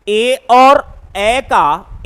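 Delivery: ए और ए का drawn out very slowly to contrast the two vowels; teacher stretches both vowels side by side; slow vowel comparison. Shown as a demonstration. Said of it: "उच्चारण तुलनात्मक कीजिए". (ए और ए का बहुत धीमा, खींचा हुआ तुलनात्मक उच्चारण)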